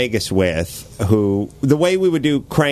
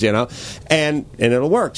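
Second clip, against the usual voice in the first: ordinary delivery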